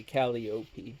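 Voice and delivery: Calliope as KAL-ee-OH-pee; alternative Calliope pronunciation. Shown as a demonstration. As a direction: say Calliope with a long OH before pee. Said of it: "back to".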